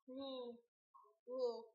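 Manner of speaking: ordinary delivery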